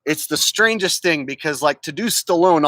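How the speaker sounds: ordinary talk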